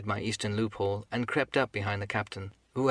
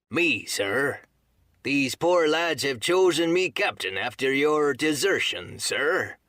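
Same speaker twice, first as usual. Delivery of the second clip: slow, louder, higher-pitched sailor's call; Long John Silver; 4 semitones higher, 4 dB louder, slower than usual. A narrator's reading. Mutineer answers the captain himself, slow called-out reply. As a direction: answer up slowly with pointed courtesy. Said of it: "Long John answered himself".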